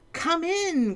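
high cheerful king call; jolly king. Acted. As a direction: sing out the invitation. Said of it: "cried the king".

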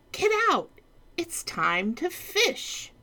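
high, eager duke call; excited duke. Acted. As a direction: lure him out with fishing. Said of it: "cried the duke".

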